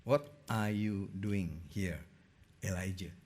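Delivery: soft, slow, hushed question; gentle soft voice. A narrator's reading. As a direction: ask it tenderly, like a still small voice. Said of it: "a gentle whisper".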